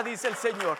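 usual speaking voice

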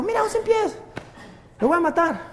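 high, soft imitation of mom; lightly voiced mom spotting a centipede; mother's voice. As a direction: mom bravely announcing the kill.

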